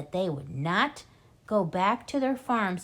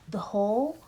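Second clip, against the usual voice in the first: whisper; 3 semitones higher than usual. A narrator's reading. Narration dips to whisper.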